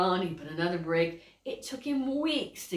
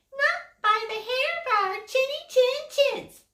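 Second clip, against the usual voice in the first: much higher squeaky pig voice; little pig voice; 4 dB louder than usual. Acted. Little pig refusing the wolf, high voice. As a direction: answer high and tiny like a piglet. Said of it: "the little pigs say".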